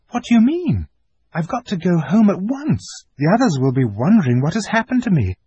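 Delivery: put-on high, hurried anxious girl; a child eager to get home; anxious girl Lucy. Lucy insists to the fawn she must go, anxious.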